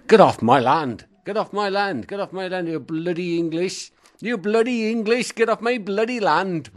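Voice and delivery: high, loud furious yell to get off his land; angry farmer shouting. Retold or imitated. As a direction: bellow like an outraged Welsh farmer. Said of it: "farmer came out and he said".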